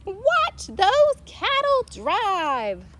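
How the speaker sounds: very high, loud, very slow cheer; crowd cheering. A narrator's reading. Townsfolk cheering the cows driving past. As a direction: call it out to the crowd. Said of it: "crowd cheering the cows".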